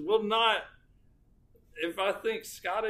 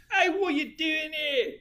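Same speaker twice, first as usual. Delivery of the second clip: high-pitched mock Scottish and New Jersey mix; attempted Scottish-New Jersey accent; 11 semitones higher than usual. Scotland Yard arriving in Jersey, muddled Scottish-New Jersey accent. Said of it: "Try to do a Scottish accent, followed directly after a fucking New Jersey accent".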